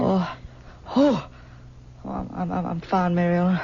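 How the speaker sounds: high, breathless stammer after a nightmare; startled awake, stammering to reassure her; waking shaken. Acted.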